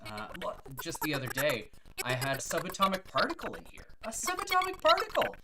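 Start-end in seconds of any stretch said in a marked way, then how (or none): 4.0-5.4 very high, loud exclamation of wonder; amazed repeat. Acted. Bartender bragging about a regular patron, amazed exclamation. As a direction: marvel at the unlikely guest.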